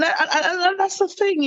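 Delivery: laughing through the words. Real, not acted. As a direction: stammer out while laughing. Laughing while searching for words.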